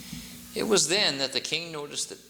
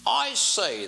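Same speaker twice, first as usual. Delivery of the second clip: pompous king; 9 semitones higher, 4 dB louder than usual. A narrator's reading.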